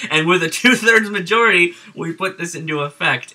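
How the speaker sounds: amused retelling. Retold or imitated. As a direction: quote him while barely holding back laughter. Retelling the chairman's absurd announcement, amused.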